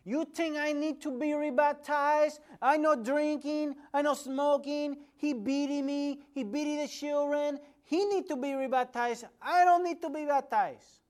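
put-on loud, high-pitched accented outburst; high, shouted tirade in broken English; blurted-out protest. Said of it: "then she just blurted out again".

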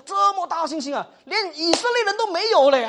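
high, loud marvelling praise; exclaimed praise. Acted. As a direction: marvel aloud at such great faith. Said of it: "赞美这一个仆人嘛". (高声惊叹赞美)

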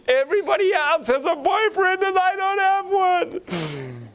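very high-pitched mock wail; pleading young lady. Young lady begs hosts to pray for a boyfriend, mock wailing. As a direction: wail it like a hammed-up, desperate girl. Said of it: "she was pleading with us".

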